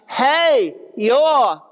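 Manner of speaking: high, drawn-out southern holler; shouting out. Acted. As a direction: call out "hey y'all" to a crowd, hammed-up. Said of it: "he's shouting out".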